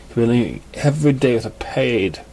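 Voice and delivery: ordinary delivery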